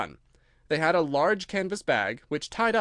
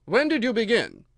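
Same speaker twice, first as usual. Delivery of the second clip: King voice; 8 semitones higher, 6 dB louder than usual. A narrator's reading.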